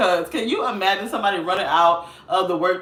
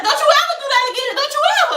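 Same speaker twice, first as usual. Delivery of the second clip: scolding lady; 4 dB louder, much faster than usual. Retold or imitated. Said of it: "she's like".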